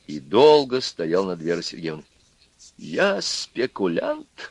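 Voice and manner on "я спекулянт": very slow, softer, higher question; mild quaver of shock; trembling voice. A narrator's reading. Husband asks in a trembling voice if he is a speculator. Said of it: "спросил он дрожащим голосом".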